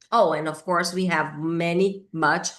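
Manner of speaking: ordinary talk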